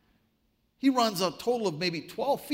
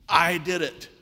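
louder, mock victory declaration; triumphant boast; 6 dB louder than usual. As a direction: proclaim it as a sudden mock triumph. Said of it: "stands triumphantly".

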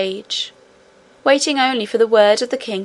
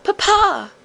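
even higher, distant-sounding call; longing cry; 10 semitones higher than usual. A narrator's reading. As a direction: reach out with the voice. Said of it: "the princess cried".

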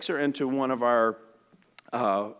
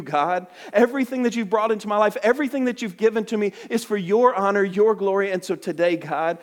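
fervent prayer; 4 semitones higher than usual. Acted. Believer surrendering life to God in fervent prayer. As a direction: pour it out to God, building in passion.

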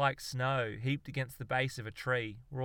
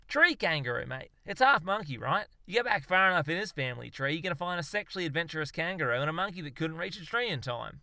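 higher, louder, quick cocky explainer; know-it-all Aussie; 5 semitones higher, 4 dB louder than usual. Acted. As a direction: bluff through the science with total confidence. Darwin explains the kangaroo.

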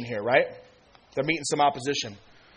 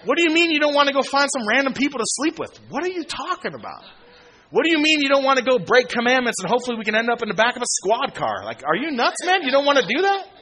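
high, loud, incredulous mock voice; mocking party friend; 8 semitones higher, 3 dB louder than usual. Preacher mimics old friends who can't believe he quit partying, high and loud. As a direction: play the baffled party buddy, way up high.

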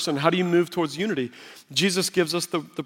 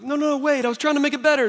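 high, hurried backpedalling; flustered protest; 9 semitones higher, much faster than usual. Retold or imitated. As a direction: imitate a husband scrambling to fix things. Retold husband's alarmed protest to wife.

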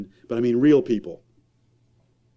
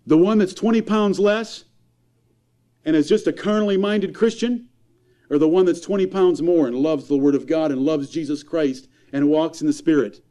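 raised, high-pitched pulpit preaching; fiery preaching; 8 semitones higher, 3 dB louder than usual. Acted. Preacher scolding parents' priorities, impassioned and raised. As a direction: preach it hard to the congregation.

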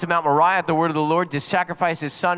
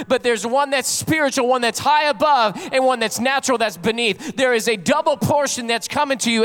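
raised preacher's voice, ringing out each phrase; full-force preaching; 5 semitones higher than usual. Acted. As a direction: preach God's promise with rising intensity.

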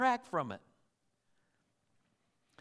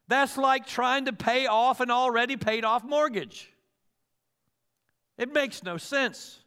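ordinary talk, nothing marked

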